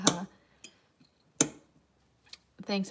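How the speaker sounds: ordinary talk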